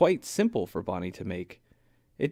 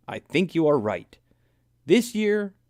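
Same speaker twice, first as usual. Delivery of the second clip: excited; 7 semitones higher, 5 dB louder than usual. A narrator's reading. Buddy voiced excitedly.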